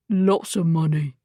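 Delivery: a second thug, voiced a little rougher. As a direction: menace in a gruffer register. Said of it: "a new, deeper voice said".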